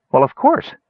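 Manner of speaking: surprised anyone would ask; startled. A narrator's reading.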